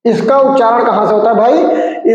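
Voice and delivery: ordinary talk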